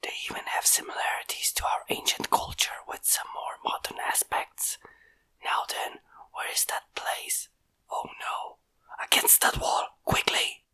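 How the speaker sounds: hushed unvoiced orders; whispered command to his bots; urgent whisper. A narrator's reading. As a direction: whisper the warning, barely heard. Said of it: "the creator issued to his creations".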